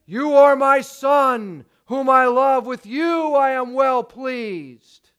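stretched-out, ringing divine announcement; voice from heaven. Acted. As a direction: declare it like God speaking from above. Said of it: "a voice came from heaven".

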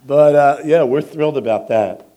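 loud, laughing delight at the applause; thrilled. Real, not acted. New great grandfather thanking the applauding congregation, laughing. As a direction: beam with joy, laughing. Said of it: "I am thrilled".

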